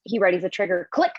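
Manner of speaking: ordinary talk, nothing marked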